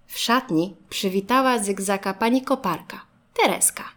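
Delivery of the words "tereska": ordinary delivery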